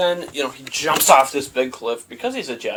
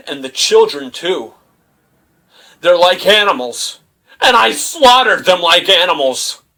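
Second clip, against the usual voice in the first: mock rage, building; 3 semitones higher, 6 dB louder than usual. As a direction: build from a flat confession to a furious cry. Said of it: "Then Anakin's just like".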